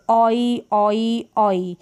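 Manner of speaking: "ऑई" as a clear oy glide, repeated; oy diphthong. Shown as a demonstration. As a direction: model "ऑई" as open aw gliding to ee. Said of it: "correct कर ले इसको".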